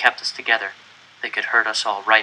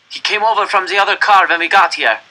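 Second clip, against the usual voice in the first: accusing man; 8 semitones higher, 5 dB louder than usual. Acted. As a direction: point him out to the guards. Pole denounces the boy.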